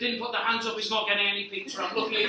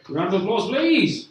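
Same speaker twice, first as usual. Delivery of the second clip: loud call; 7 dB louder than usual. Real, not acted. Host calling out to crowd.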